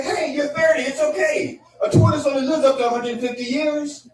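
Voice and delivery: high, hammed-up friend voice; upbeat friend. Friends approving a pet at thirty, exaggeratedly upbeat.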